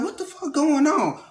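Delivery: shocked mom. Retold or imitated. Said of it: "my mama like".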